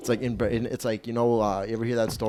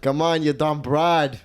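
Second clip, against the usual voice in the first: exasperated Italian-American backseat driver, pitched up; Italian son nagging Ma; 4 semitones higher, slower than usual. Acted. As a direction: berate Ma in a hammed-up Italian wise guy voice. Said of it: "when you're talking to your mom like this".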